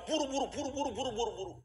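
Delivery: tiru pelanggan yang mendesak, nada jauh lebih tinggi; customer galak yang mendesak. Retold or imitated. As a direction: ulang "buru" cepat seperti bos tak sabar. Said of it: "Dia maunya apa?". (suara pelanggan galak yang terus mendesak)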